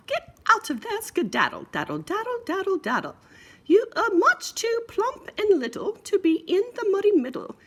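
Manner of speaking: hammed-up fussy mother; high mommy pig scolding. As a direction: shoo the piggy out.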